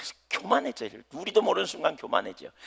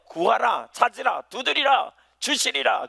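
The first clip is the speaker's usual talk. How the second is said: higher, louder, slowed proclamation; preaching fervently; 7 semitones higher, 3 dB louder, slower than usual. Acted. Preacher proclaiming the scripture to the congregation, fervent. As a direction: ring the verse out to the hall. Preaching the verse with rising force.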